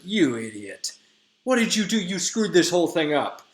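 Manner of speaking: lightly acted exasperation; exasperated scolding. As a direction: scold a bungling helper, half in earnest. Oberon scolds Robin, acted retelling.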